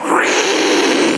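sharp breathless mock gasp; a sudden intake of shocked breath. Daughters reacting in shock to their dad, imitated gasp.

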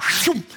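quick "shoom" whoosh; vocal sound effect of rushing past; imitated whoosh. Storyteller miming frantic cleaning, whooshing sound effect.

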